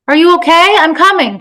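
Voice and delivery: loud, high, worried shout; panicked call. Acted. Woman calls to her fallen toddler, panicked. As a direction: call urgently to a hurt child. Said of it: "she called, panic making her sweat".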